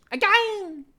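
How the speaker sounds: put-on high, loud squealing baby voice; baby shouting. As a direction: shriek it like a delighted toddler. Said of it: "The baby laughs and shouts".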